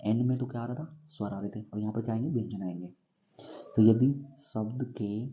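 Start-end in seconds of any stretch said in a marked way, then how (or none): 3.8-5.2 slow, word by word, for notes; slow dictation. Shown as a demonstration. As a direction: spell out the rule slowly for students to write. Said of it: "dictating the rule slowly". (धीमे बोलकर लिखवाना)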